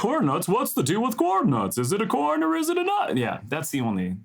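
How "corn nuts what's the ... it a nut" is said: stand-up comic impression, pitch climbing high; Jerry Seinfeld. Co-host showing the best corn nuts line, rising comic impression. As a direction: build to an incredulous high-pitched question. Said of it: "the best Seinfeld line like that".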